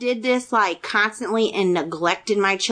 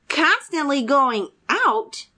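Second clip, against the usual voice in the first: slow, higher, rising question; incredulous echo; 5 semitones higher, slower than usual. Retold or imitated. Daughter answering mother's accusation, incredulous echo. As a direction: throw the accusation back in disbelief, drawn out.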